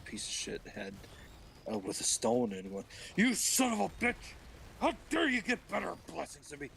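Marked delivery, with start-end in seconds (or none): 3.2-6.7 mock furious yell. Acting out the Cain quarrel, mock fury. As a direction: rant in hammed-up outrage.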